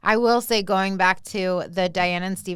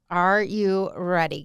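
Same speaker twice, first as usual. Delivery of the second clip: usual speaking voice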